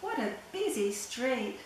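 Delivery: ordinary delivery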